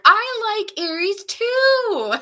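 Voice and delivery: high, loud, drawn-out mock declaration; eager rival suitor. Rival cutting in to claim Aries, hammed-up eager cry.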